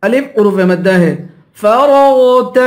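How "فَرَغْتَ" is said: فَرَغْتَ with heavy ر and غ; full-mouthed letters. Shown as a demonstration. Teacher showing heavy letters, فَرَغْتَ with full رَغْ. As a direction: chant فَرَغْتَ, thickening the middle. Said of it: "دونوں کو پر پڑھیں گے".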